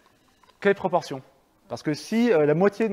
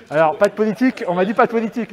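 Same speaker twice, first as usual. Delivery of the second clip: laughing, higher and louder; amused, raised-voice disclaimer; amused protest; 4 semitones higher, 7 dB louder, faster than usual. Real, not acted. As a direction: protest loudly through a laugh. Laughs off a political remark.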